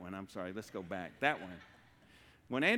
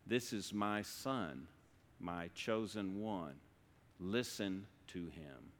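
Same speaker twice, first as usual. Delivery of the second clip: slow, lower, solemn divine voice; voice from the cloud; 4 semitones lower, 6 dB quieter, slower than usual. Acted. Voice from the cloud to the disciples, slow and solemn. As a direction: speak slowly and softly, like God from a cloud. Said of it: "Then a voice from the cloud said".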